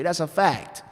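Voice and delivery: ordinary talk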